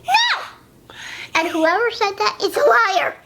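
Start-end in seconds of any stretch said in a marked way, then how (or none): 0.0-0.9 high sharp denial; indignant shout. Real, not acted. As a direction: shout it in protest. Denies being sassy.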